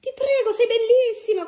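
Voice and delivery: high, loud gushing praise. Real, not acted. Mother gushing over her daughter's new outfit, high and loud. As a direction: rave over how she looks.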